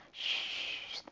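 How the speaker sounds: soft shh of the wind; wind hiss. Acted. Teacher making the wind sound for the children, a soft shh. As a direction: breathe out the wind gust.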